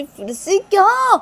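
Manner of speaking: high, loud, drawn-out protest; exasperated. Acted. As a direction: whine in disbelief at yet another demand. Exasperated at another demand. (झुंझलाकर ऊँची, खिंची आवाज़)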